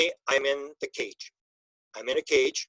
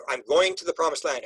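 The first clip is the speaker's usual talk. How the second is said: ordinary talk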